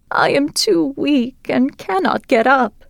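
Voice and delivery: high, slow and frail; weak old grandmother. Acted. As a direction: sound too weak to get up. Sick grandmother in bed.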